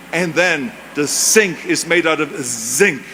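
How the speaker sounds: "sink" and "zinc" spoken slowly, with a clear S in "sink" and a clear Z in "zinc"; pronunciation drill. Shown as a demonstration. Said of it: "the Germans always had difficulties with the F and the W and the V".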